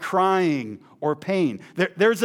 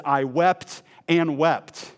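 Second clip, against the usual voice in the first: slow emphasis; much slower than usual. Acted.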